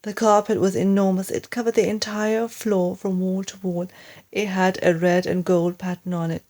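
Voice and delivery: usual speaking voice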